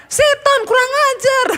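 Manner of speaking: very high, loud squawking parrot. Acted. Preacher voicing the ruined parrot's squawked curse. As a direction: screech it out like a cursing parrot. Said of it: "dia bilang".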